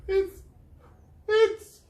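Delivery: very high, loud stammer; trembling. Acted. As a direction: stammer in shock. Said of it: "trembled Miss Gum".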